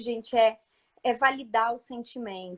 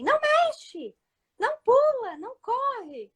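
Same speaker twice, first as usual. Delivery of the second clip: mock yelling parent; 11 semitones higher, slower than usual. Parent shouting no at a busy toddler. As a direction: yell no at a child touching everything. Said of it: "não adianta você ficar gritando aí".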